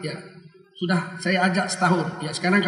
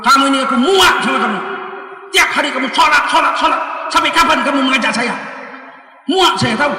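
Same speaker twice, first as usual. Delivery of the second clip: loud, higher-pitched exasperated outburst; raised voice, acted annoyance; fed-up angry friend; 5 semitones higher, 4 dB louder than usual. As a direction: act out someone sick of being nagged. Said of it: "Sampai dia marah".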